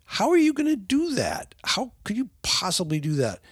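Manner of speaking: very high, disbelieving squeal; shocked father can't believe it; incredulous. Retold or imitated. Father reacting to son's surfing plan, high incredulous squeal. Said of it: "the surfing thing is just like".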